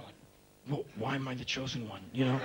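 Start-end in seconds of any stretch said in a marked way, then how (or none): 0.7-2.0 puzzled mutter. Retold or imitated. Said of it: "Ken's going".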